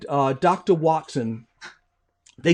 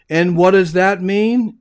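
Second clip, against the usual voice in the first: slow, louder probing Sherlock; slow insistent question; 5 dB louder, slower than usual. Acted. Sherlock pushing Watson for the meaning, slow and firm. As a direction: press him for more, slowly.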